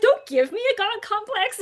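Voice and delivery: high, loud laughing protest; mock protest. Teacher answering students who call her creator, mock protest. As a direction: push the title away with a laugh.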